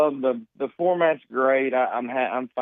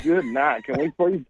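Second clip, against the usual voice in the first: higher-pitched, laughing exasperation; mock exasperated plea; 7 semitones higher than usual. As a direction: plead in comic disbelief, voice pitched up. Mock plea in retold reaction.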